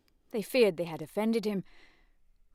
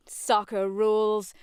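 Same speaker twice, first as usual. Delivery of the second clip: retort; 3 semitones higher, 6 dB louder, slower than usual. Acted. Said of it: "George retorted".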